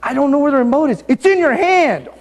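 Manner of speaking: rising high and loud, feigning ignorance; a husband pretending he can't find the remote; mock innocent husband. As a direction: protest your innocence a little too hard. Husband playing dumb with remote.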